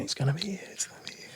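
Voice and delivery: teasing whisper. Retold or imitated. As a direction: whisper it at the bandmates. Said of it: "stitching up everyone else going".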